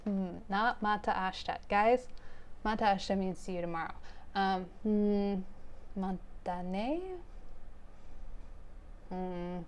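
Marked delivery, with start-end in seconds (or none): none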